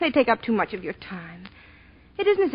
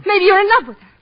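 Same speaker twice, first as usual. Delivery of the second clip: higher, louder jealous accusation; jealous outburst; 10 semitones higher, 10 dB louder, faster than usual. Acted. Jealous wife lashing out at her husband in their bedroom. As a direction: throw the accusation at him.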